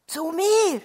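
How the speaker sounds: loud, high cry of disbelief; startled shout in a read story; shouted outcry. A narrator's reading. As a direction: shout it out, pitch jumping up. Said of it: "schrie er".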